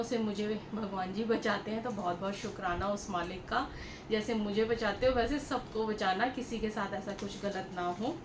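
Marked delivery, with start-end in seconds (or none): none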